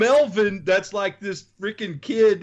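ordinary talk, nothing marked

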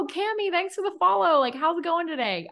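high friendly hello to a follower; upbeat voice note; casual voice memo. Pretend. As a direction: greet Cami like a pal. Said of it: "voice memo them and be like".

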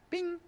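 a high ping like a phone alert; high notification ping; email bing. Retold or imitated. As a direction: chirp it like a notification.

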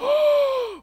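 high sharp gasp of surprise; crowd gasp. Retold or imitated. The whole crowd gasping when the lights went out. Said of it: "everybody's like".

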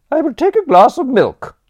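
high, loud haughty aunt; imperious aunt. A narrator's reading. Aunt refusing absinthe, high and loud. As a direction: pronounce it like a stern dowager. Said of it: "Aunt Agatha's voice".

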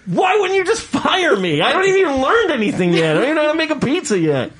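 high, laughing disbelief; plead, amazed, half laughing; incredulous rant. Retold or imitated. To the nitpicking Pizza Hut boss, high incredulous laughter.

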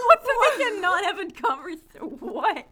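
laughing, high and loud. Real, not acted. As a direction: laugh through the words. Laughing at story.

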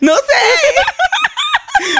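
squealed very high through laughter; shaken, laughing it off in a squeal; laughing shriek. Real, not acted. Cohost explains what she thought fell, squealing with laughter.

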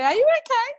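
retold question pitched right up; imitated concerned stranger. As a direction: mimic a shop woman asking with concern. Retold concerned woman in shop.

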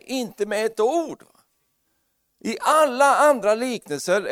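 high, loud, fervent preaching; full-force preaching. Acted. Pastor preaching hard to the congregation about the Canaanite woman. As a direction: drive the point home to the church.

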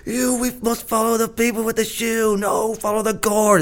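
high, slow falsetto crowd voice; squeaky Monty Python-style crowd; Life of Brian crowd voice. Retold or imitated. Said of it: "they say".

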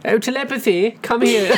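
high, loud posh mum voice; posh mother calling. Acted. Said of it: "really posh girl's name".

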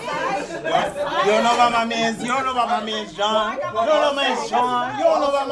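loud, high hype chant; hype shout. Real, not acted. Hype calling out a friend.

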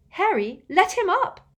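high, loud plea; urgent protest. A narrator's reading. Hermione begs Harry to release elf, urgently. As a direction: cry out to stop him.

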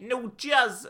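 high, loud New York "Jersey"; mock New York accent. Host tries a New York accent, high and squeaky. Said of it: "Is this a New York City accent?".

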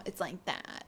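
soft creaky demonstration; quiet, fried phrase ending; vocal fry. Shown as a demonstration. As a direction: drop softly into a creak. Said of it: "vocal fry is this thing".

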